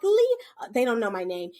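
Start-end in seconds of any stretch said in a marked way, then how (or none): 0.0-0.4 put-on much higher, louder kid call; calling child. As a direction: call out like an eager little kid. Kids calling the teacher.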